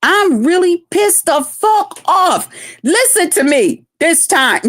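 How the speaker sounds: high, slow, emphatic telling-off; serious, pissed off. Retold or imitated. Aunt shows nieces she is not joking, retold anger. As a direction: lay down the law, drawing out the words. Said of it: "She's serious now".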